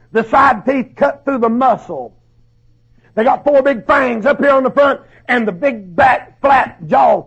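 loud, high, hammering sermon delivery; fiery preaching. Acted. Preacher warns parents and young people about wolves, at full force. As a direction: preach it hard to the congregation.

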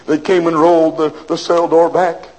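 ordinary talk, nothing marked

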